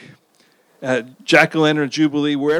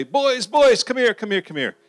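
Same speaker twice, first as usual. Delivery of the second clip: put-on a grandfather calling the boys over, higher; 7 semitones higher than usual. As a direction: call the boys in from across the yard. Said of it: "he would say".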